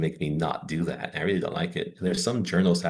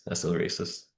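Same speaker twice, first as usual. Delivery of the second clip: whispered aside; 6 dB quieter than usual. Retold or imitated. Repeats her own retort.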